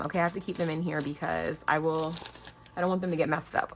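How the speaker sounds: ordinary delivery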